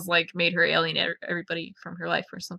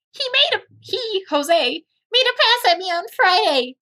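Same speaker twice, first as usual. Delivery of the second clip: put-on much higher, embarrassed girlish voice; flustered young woman; 12 semitones higher than usual. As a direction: blurt out the awkward confession. Reading Anastasia's flustered confession.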